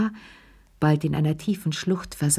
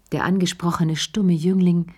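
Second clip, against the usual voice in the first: plain reading voice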